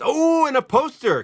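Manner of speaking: sudden high delighted burst; thrilled at the pull, voice jumping up; real excitement over a pack pull; excited. Real, not acted. Pulling a poster from a pack.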